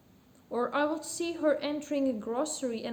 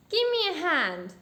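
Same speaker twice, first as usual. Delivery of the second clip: louder, higher demand; commanding customer; 5 semitones higher, 6 dB louder than usual. A narrator's reading. Lady demands the butcher's help, commanding. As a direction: call out an order to a shop servant.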